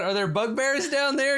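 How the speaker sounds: high-pitched mock shout; imitated shout. Game master echoes a player's corridor call, high mock shout. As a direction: repeat a call high, without full volume. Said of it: "So you shout, you shout".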